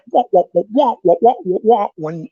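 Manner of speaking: mocking wah-wah chatter; mock blah talk. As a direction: mimic droning talk as wah wah. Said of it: "it goes".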